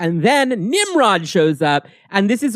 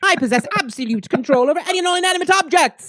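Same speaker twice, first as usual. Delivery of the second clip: high-pitched imperious boast; Selene; 9 semitones higher, faster than usual. Acted. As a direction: declaim it like a comic-book villainess. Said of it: "And Selene says".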